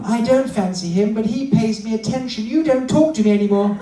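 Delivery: high, aggrieved wife puppet; put-on high female character; feminine voice. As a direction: tell the grievance as the lady dummy. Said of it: "let's find a nice feminine voice".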